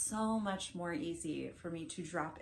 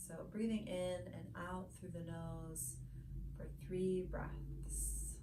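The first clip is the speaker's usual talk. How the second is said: soft, unhurried meditation guide with long pauses; hushed, soothing body-scan guidance, phrase by phrase; guided meditation; 7 dB quieter than usual. Real, not acted. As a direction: ease them gently into stillness, voice lowered.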